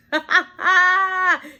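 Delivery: high, loud cackle; laughing. Real, not acted. Laughing at her own hype of Devin, high cackle. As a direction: burst out in a big high laugh.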